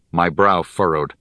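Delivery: plain reading voice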